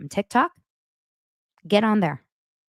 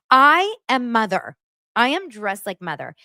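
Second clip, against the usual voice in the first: mock diva; 6 semitones higher, 5 dB louder than usual. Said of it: "Angie goes".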